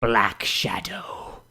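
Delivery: slow, low, dramatic whisper; edgy ninja whisper. Acted. Ninja OC introducing himself to Twilight, in a slow edgy whisper. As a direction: hiss the name like a brooding hero. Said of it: "Call me".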